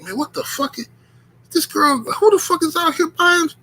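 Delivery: starts hushed then jumps high; mock horror rising to a squeal; disgusted disbelief. Retold disgusted reaction.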